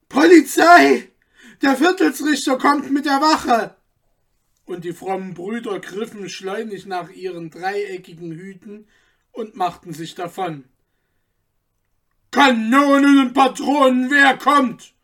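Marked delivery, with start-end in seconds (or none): 0.1-3.8 high, loud cry of alarm; alarmed shout. A narrator's reading. A cobbler shouting a warning to the revellers, loud alarm. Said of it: "rief einer von den erweckten Schustern". 12.3-14.9 high, loud startled yell; wild shout. A narrator's reading. Anton jumping up at the intruder, a wild high shout. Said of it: "schrie Anton und erhob sich wild".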